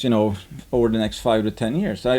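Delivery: ordinary talk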